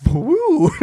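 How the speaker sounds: high, loud swooping whoop; imitated flip whoosh. Hunter showing how the deer flipped, high whooping whoosh. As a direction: send a big whoop flying up and over. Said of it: "The deer went".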